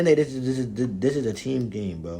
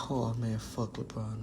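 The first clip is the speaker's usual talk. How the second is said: normal talking voice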